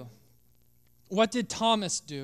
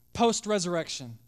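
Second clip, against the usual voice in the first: usual speaking voice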